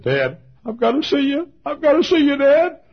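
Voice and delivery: high, loud, pleading son's voice; retelling a weeping son's plea; tearful son. Retold or imitated. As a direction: beg your father through tears. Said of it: "tears were streaming down his cheeks".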